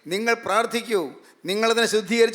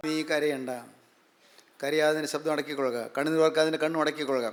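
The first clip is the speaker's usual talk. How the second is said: much softer and lower, gently consoling; tender comfort; 7 semitones lower, 7 dB quieter than usual. Acted. Preacher voicing God to weeping Israel, soft and tender. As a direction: hush them like a parent soothing a crying child.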